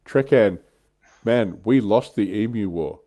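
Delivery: ordinary talk, nothing marked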